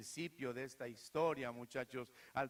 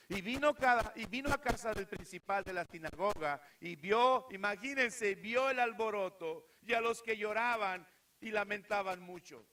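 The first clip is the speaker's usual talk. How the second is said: forceful preaching; 5 semitones higher, 7 dB louder than usual. A narrator's reading.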